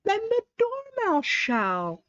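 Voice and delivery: high, slow, gleeful voice; two guests crying out together; mad tea-party guests. A narrator's reading. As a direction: announce it slowly up high. Hatter and Hare voiced together.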